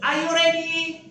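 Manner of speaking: usual speaking voice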